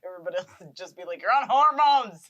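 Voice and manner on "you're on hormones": loud, slow, high-pitched mock shout; hammed-up scornful holler; jeering heckler. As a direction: heckle the act from the crowd. Imitating mocking heckler at freak show.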